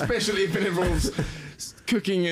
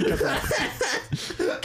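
laughing; 12 semitones higher than usual. Real, not acted. Laughing while asking to cut.

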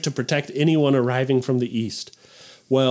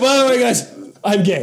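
mock casual announcement; 8 semitones higher, 4 dB louder than usual.

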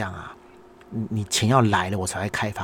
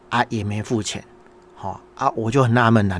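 ordinary delivery

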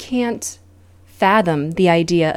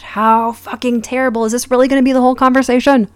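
exasperated outburst; 6 semitones higher, 5 dB louder, faster than usual. Real, not acted. Exasperated by the dinner talk.

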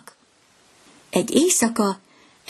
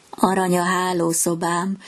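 usual speaking voice

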